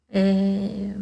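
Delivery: normal talking voice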